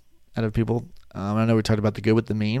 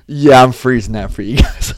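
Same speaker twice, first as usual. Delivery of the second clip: louder words through laughter; laughing; 3 semitones higher, 14 dB louder than usual. Real, not acted. Laughing to viewers while freezing the video.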